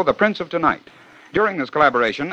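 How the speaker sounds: usual speaking voice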